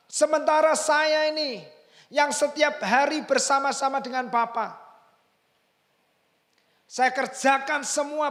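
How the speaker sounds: high, loud furious complaint to his father; elder son shouting at his father, high and loud; angry shouting son. Retold or imitated. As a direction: yell out years of resentment. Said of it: "Dia teriak".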